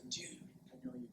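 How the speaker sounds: hushed, whispered creeper. Shown as a demonstration. Coach showing the room how to say it, whispered creeper voice. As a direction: whisper it like a close-talking creep. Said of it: "Everybody in creeper voice says".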